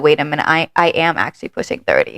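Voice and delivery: low, dazed imitation of Brian; mock realization. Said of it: "he's like".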